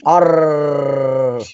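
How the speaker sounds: Arr with a long, hard rolled r; heavy rolled r. Shown as a demonstration. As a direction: growl Arr with a trilled r. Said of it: "roll your R's excessively long".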